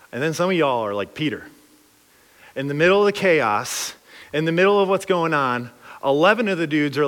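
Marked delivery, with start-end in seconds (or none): none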